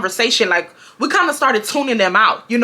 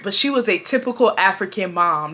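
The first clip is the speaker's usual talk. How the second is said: usual speaking voice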